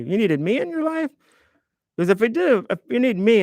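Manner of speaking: light up at the compliment; delighted surprise. Real, not acted. To a viewer who needed him, surprised and delighted.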